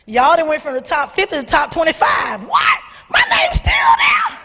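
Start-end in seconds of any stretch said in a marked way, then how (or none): 2.4-4.4 very high squeal of surprise; squealing disbelief. Retold or imitated. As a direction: shriek it in happy shock. Disbelief at still being listed.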